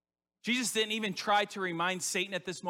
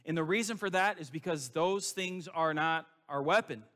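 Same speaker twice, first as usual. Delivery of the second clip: ordinary delivery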